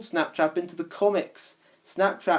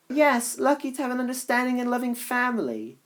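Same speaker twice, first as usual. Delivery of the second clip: high female character voice; 9 semitones higher than usual. Acted. Kitty agrees.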